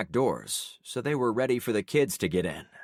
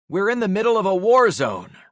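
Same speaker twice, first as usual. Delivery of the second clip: loud, higher exasperated shout; 11 semitones higher, 9 dB louder than usual. A narrator's reading. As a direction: yell over the brawl. Breaking up a fight.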